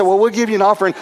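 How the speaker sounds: high, hurried-sounding concession; hasty reassurance. Retold or imitated. Pastor hastily offering money to the preacher, high and flustered. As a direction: blurt it out high.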